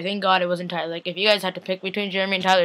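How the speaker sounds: usual speaking voice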